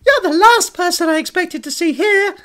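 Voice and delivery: very high, loud puppet voice, surprised; little annoying puppet squawk; children's TV character. Acted. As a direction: pipe up like a cartoon character. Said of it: "sound like a character from a children's T V show".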